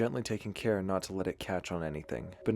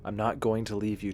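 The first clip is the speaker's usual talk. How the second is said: plain reading voice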